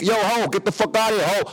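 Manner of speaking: high-pitched retold kick-out; mock yell to chase someone off; embarrassed anger. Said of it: "'cause I was embarrassed".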